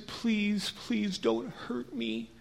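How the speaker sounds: high, soft, slow begging; timid pleading. Acted. Wounded man begs his Samaritan enemy, timid pleading.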